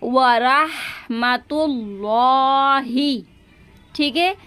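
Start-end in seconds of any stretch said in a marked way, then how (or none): 0.0-3.2 वरहमतुल्लाही very slow with an audible ह; clear ha sound. Shown as a demonstration. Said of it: "हा की आवाज़ आएगी". (ह की आवाज़ साफ़)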